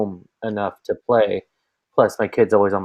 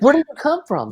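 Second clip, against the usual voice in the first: put-on startled kid. Quoting son surprised in game.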